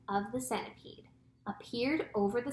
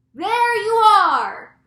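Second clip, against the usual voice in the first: high, booming, drawn-out cry; slow triumphant yell; cried out; 11 semitones higher, 16 dB louder, much slower than usual. A narrator's reading. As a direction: shout it as proof you were right. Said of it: "cried the chief of police".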